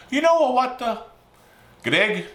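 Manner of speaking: high, loud imitation of dad's Italian accent. Dad telling Greg about the bread job, Italian accent. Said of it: "This is my dad's accent".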